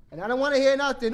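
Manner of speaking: loud, fast bedtime warning; scolding parent. Pretend. Mock parent warning children before bed.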